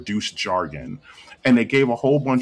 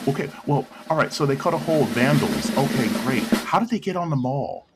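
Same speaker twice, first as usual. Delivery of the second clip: higher-pitched mock interviewer; questioning news person; 6 semitones higher than usual. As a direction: lightly imitate a reporter pressing for answers. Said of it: "the news person that was asking them questions".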